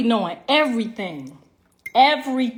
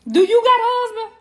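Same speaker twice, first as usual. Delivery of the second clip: mock indignant; 10 semitones higher, 4 dB louder than usual. Real, not acted. Teasing a woman in the crowd.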